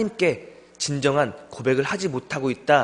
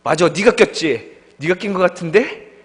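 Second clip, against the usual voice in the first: fake agreement; 5 semitones higher, 6 dB louder than usual. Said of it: "그 친구의 말에 동조합니다".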